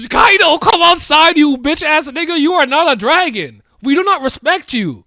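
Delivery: loud, high, hyped yelling at the screen; excited shouting. Real, not acted. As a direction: scream it out like a fan losing it.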